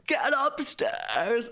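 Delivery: high, drawn-out mock order; panicked mum. Daughter imitating mum after positive test, high panicked order.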